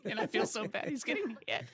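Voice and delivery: high-pitched, comic wail of sympathy; mock distress. Actor retells watching a colleague get shot, high mock distress. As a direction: squeal it as laughter breaks out. Retells crew's horror amid laughter.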